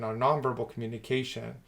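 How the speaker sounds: normal talking voice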